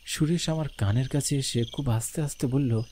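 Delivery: plain reading voice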